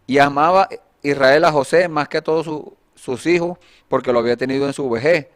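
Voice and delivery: loud, projected Bible reading; declaimed verse with emphatic phrase openings; scripture reading. Acted. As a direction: read the passage out firmly to a congregation. Reads Genesis passage aloud.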